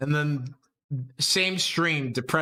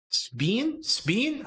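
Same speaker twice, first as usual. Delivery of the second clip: rising question; 7 semitones higher than usual. Real, not acted. Asking chat whether to spin.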